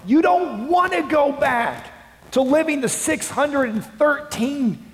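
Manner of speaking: high, loud, impassioned plea; fervent preaching shout. Acted. Paul writing to the Galatians church, fervent loud plea. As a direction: proclaim it like Paul rebuking the church. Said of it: "and says".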